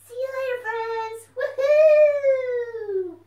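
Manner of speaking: high, loud, excited puppet voice; a bouncy little puppet popping up; puppet fox voice. Acted. Puppet fox greets the children, high and loud.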